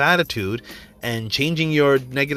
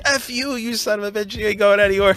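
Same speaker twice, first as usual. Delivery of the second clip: much higher mock voice for the broken car; car talking back; 7 semitones higher than usual. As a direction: give the car a sneering squeaky voice. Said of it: "My car was like".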